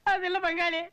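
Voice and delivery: high, weepy whimpering voice; crying plea. Acted. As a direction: beg while sobbing. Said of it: "அழாத, அழாத". (அழுதுகொண்டே கெஞ்சுதல்)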